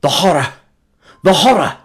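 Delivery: high, loud repeated cry; anguished outcry. Acted. Narrator recalling Kurtz's last words, loud anguished cry. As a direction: let the horror tear out. Whispered dying words, swelling.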